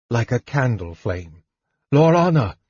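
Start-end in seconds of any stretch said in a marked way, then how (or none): 1.9-2.6 loud, high call of a name; crying out. A narrator's reading. Fighter spotting her across the tumult, crying out. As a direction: cry out to reach someone far off. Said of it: "he cried".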